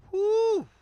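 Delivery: loud, high celebratory whoop; sports fan's joyful holler; excited whoop. Real, not acted. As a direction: let out a big cheer for football. Cheering NFL being back.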